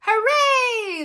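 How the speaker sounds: high, loud hooray; drawn-out rousing shout; shouted cheer. A narrator's reading. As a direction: cheer it out as a crowd. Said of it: "the girls shouted".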